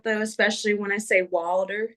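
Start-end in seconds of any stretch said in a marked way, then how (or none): 1.3-1.9 "Wilder" in a Kentucky accent. Shown as a demonstration. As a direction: say "Wilder" with your own Kentucky twang. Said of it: "I do have a little bit of, like, an accent".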